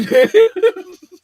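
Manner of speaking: loud high-pitched cackle; high burst of laughter. Real, not acted. Vlogger cracking up at own phrase, loud high laugh.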